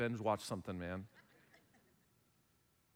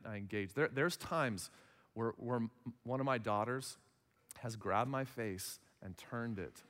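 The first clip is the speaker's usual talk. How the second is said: normal talking voice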